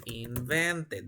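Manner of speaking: "invented" slowly, syllable by syllable, ending in -ted; teacher spelling out "invented" slowly and quietly; slow modelling. Shown as a demonstration. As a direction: draw out "invented" with a clear -ted. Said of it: "¿Cómo se pronunciará esta palabra?".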